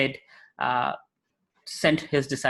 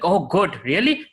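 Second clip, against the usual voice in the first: eager surprise; 3 semitones higher, 4 dB louder, slower than usual. Acted. Lusty grocer voiced in story.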